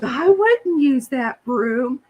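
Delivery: slow, a hint of the mortified lady; mortified shop lady. Shop lady refusing to use the broom, slow and horrified. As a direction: draw out the lady's horrified reply. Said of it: "She goes".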